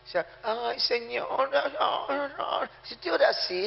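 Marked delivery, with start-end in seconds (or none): 0.4-2.7 slow, high, soft whining lament; mock lament. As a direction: wail to the Lord like a defeated complainer. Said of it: "este es un lamento eso". (lamento quejumbroso de burla, lento y agudo)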